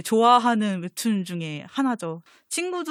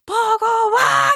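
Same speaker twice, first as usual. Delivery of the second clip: long, higher, louder drawn-out shout; mock call-out; 13 semitones higher, 8 dB louder, much slower than usual. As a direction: call the name across the room, stretched. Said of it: "이러는 장면이 있어요".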